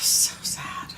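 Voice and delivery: breathy whispered so sad. Real, not acted. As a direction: whisper it like a sigh of loss. Mourning lost football players.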